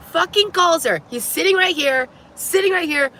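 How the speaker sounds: incredulous venting; outraged. Real, not acted. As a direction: disbelief boiling over. Outraged retelling.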